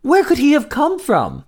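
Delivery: high, cooing wonder; delighted astonishment. A narrator's reading. Queen looking at the baby, high delighted wonder. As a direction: marvel over the baby in a high voice. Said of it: "she gasped as she looked at the baby".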